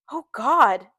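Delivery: loud, mildly miserable exclamation. A narrator's reading. Gatsby to his friend in the kitchen, miserable. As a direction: groan it out in misery, lightly acted. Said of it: "in a miserable way".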